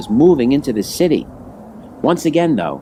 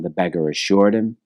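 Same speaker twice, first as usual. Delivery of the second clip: ordinary delivery, nothing marked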